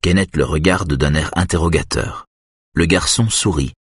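ordinary delivery, nothing marked

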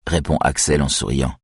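ordinary delivery, nothing marked

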